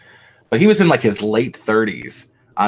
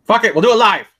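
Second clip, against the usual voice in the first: higher, louder hammed-up outburst; mock rant; 12 semitones higher, 7 dB louder, faster than usual. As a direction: belt it like a fake tantrum. Quoting famous rant as joke.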